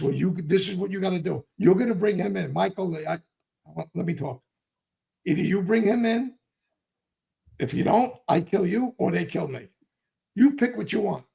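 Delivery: reading the riot act to a cousin. Retold or imitated. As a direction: lay down an ultimatum, hard. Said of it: "I just read him the riot act".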